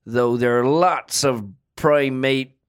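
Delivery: put-on a light Yorkshire accent, unhurried. A podcast host asked for Yorkshire names his favourite apes, in a light Yorkshire accent. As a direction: talk about apes like a Yorkshire local, lightly done. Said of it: "What about a Yorkshire?".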